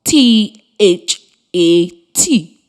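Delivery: "W A S" letter by letter, slowly; spelling out. Shown as a demonstration. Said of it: "it is spelled".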